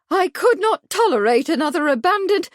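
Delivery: alarmed spinster. A narrator's reading. Truebody alarmed continuation.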